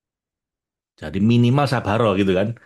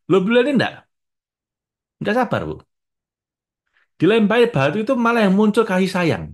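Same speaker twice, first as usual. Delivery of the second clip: much higher and louder, preacher's fervour; excited emphasis; 12 semitones higher, 5 dB louder than usual. Real, not acted. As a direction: lift it up with fervent wonder. Excited praise of the Prophet.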